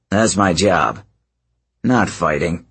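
understated disappointment; crestfallen. Acted. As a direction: let the pride sag a little. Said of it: "He sounded a little crestfallen".

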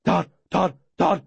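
yappy barked name; dog barking a name. Acted. Dog barking to lure the man away, yappy. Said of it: "My dog barking my name".

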